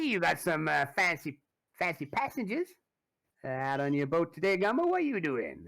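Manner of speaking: high, loud cocky thug voice with a big laugh; mocking swamp gangster sizing up the boat; sneering high-pitched river heavy; Derek Lazenby. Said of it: "He goes".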